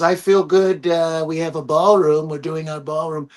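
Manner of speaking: lightly voiced Trump, proud of the ballroom; a hint of a smug president; imitating Trump. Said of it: "He goes".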